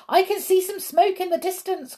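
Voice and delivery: high-pitched calling out; excited cry. A narrator's reading. Onlooker spotting the train, high excited cry. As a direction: cry out the sighting. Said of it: "cried someone".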